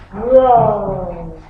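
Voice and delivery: low, drawn-out lion roar; mock roar. Shown as a demonstration. Teacher answering her own question, low mild roar. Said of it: "A lion do what?".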